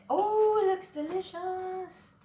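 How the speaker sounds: high, drawn-out coo of delight; delighted admiration. Real, not acted. Cook admiring the tortilla puffing in the pan, cooing with delight. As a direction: coo over the food, high and slow.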